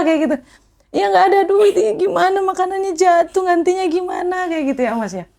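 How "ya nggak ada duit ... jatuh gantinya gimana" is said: higher, imitating her own crying on the phone; a hint of a weeping girl asking why; retold crying. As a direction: replay the tearful call, mildly. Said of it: "Saya langsung nangis".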